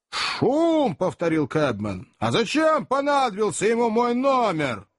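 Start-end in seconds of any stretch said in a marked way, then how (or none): 0.1-1.0 indignant echo. Acted. Cabman angrily echoes the question. 2.2-4.9 high-pitched indignant cabman; сварливое возмущение. Acted. Cabman quarrelling with his fare.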